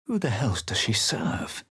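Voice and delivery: tense, unvoiced whisper. Whispering suspicion to companions about the stranger. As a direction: hiss the question under your breath.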